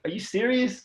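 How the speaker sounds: high, stunned disbelief; retold disbelief. Told the new team heard his knee was bad, stunned. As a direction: ask it as if you can't believe it.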